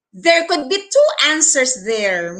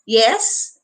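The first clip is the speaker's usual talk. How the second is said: helpful "yes?" lifted at the end; inquiring, upward "yes?"; rising intonation. Shown as a demonstration. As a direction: say "yes" rising, as if asking how to help. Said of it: "raise the intonation with yes".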